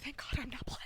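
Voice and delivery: relieved whisper to oneself. Retold or imitated. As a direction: breathe it out in relief. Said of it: "Sometimes I'm like".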